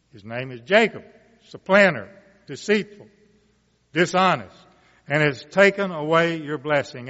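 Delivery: measured, rising Bible reading voice; scripture reading. Acted. Preacher reading Genesis aloud to the congregation, solemn and building. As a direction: read the blessing to the congregation, building.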